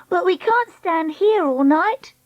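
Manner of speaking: high, drawn-out wail of protest; crying out. Acted. Treacle stranded on the trolley, crying out in protest. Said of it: "Treacle cried".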